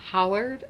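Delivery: "Howard" said as the islanders' two-syllable how-ard for hard; Tangier pronunciation of hard. Shown as a demonstration. Reading a book's dialect example to viewers, two-syllable "Howard". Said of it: "Hard comes out as".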